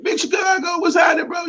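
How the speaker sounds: high, loud hammed-up greeting; mock an overeager stranger hailing Chicago; host imitates excited out-of-towners; excited fan greeting. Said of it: "people get excited".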